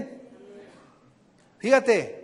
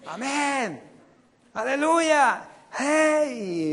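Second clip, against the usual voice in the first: higher, fired-up amens and aleluya; mock excited congregation; 7 semitones higher, much slower than usual. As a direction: imitate a worked-up crowd cheering. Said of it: "¿Y sabe qué contestan?".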